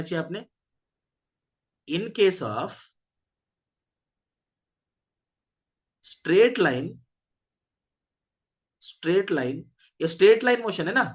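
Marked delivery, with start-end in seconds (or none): 1.9-9.6 slow note dictation with long pauses; dictating. Real, not acted. Teacher dictating a note to the class, dictating. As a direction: spell it out phrase by phrase for note-takers.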